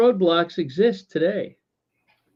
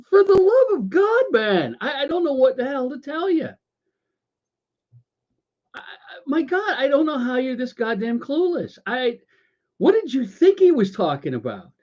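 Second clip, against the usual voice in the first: exasperated retort; 6 semitones higher than usual. Retold or imitated. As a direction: throw up your hands at his cluelessness. His exasperated retort to the caller.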